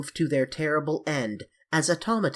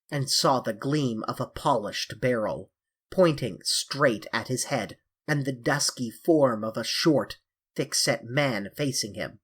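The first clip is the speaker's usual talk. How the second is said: ordinary delivery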